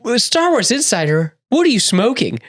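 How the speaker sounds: high-pitched mock disbelief; mock incredulous. Podcaster recalls reacting to Star Wars Insider, mock incredulous. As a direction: challenge them in exaggerated, high-voiced disbelief. Mock challenge to a magazine's choices.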